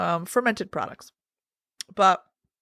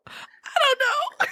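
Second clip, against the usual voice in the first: laughing shrug; much slower than usual. Real, not acted. Laughing trail-off.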